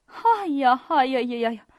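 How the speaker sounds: loud, rippling "aiya" of dismay; collective exclamation. A narrator's reading. Several guests exclaiming at once, startled. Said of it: "几个人异口同声地说道".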